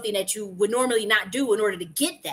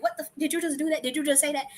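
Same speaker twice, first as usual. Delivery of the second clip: higher, fast, disbelieving outburst; shocked disbelief; 7 semitones higher, faster than usual. Retold or imitated. The speaker, to someone who startled her, retold shock. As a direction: blurt it out in stunned disbelief.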